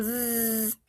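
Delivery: "Z" as a sustained buzzing zzz; French Z. Shown as a demonstration. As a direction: hold "Z" as a voiced buzz. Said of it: "va a sonar a la Z francesa".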